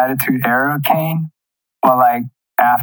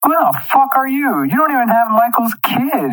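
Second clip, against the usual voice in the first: mock outraged yelling at the TV; outraged rant; 10 semitones higher than usual. Fan yelling at Jan on screen, high indignant rant. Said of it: "I was like".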